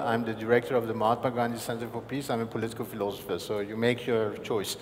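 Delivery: ordinary talk, nothing marked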